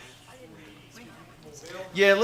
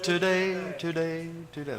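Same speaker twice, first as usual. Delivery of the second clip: Today, today, today in a dotted beat, getting softer; rhythm word, fading; 4 semitones higher, 4 dB quieter, slower than usual. Shown as a demonstration. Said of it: "That works fine".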